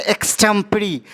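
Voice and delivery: एक्सटेम्परी said as ex-TEM-pe-ree, four syllables; correct pronunciation. Shown as a demonstration. Teacher models the word for students, clear and careful. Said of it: "इसे हम पढ़ेंगे".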